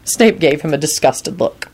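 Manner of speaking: ordinary delivery, nothing marked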